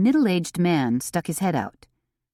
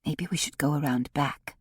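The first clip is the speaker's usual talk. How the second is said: hushed and softer; whispering; 5 dB quieter than usual. A narrator's reading. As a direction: keep it down, sneaking up. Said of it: "whispered Theodosia".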